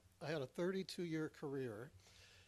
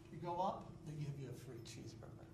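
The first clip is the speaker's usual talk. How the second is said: usual speaking voice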